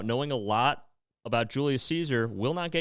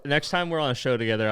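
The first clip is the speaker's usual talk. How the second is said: ordinary talk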